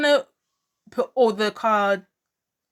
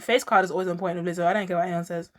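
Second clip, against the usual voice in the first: normal talking voice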